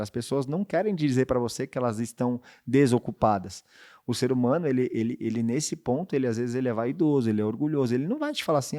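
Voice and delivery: ordinary talk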